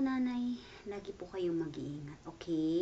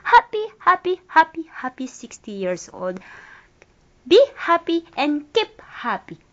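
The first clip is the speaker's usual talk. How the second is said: loud, high, cheering birthday greeting; excited shouting; 3 semitones higher, 17 dB louder than usual. Real, not acted. Child shouting birthday wishes to nanay, loud and excited. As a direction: call it out with big birthday joy.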